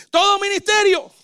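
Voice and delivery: preacher's loud emphatic burst; emphatic exclaim. Real, not acted. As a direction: hit it hard for the crowd. Preacher punching his point.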